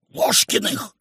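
voiceless whispered answer; emphatic stage whisper; loud whisper. A narrator's reading. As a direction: hiss the name secretly. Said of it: "сказала громким шёпотом".

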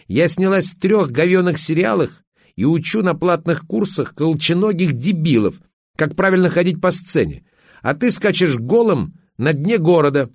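wife's high-pitched scornful rant; heated quarrelling wife. Acted. Acted quarrel of husband and wife.

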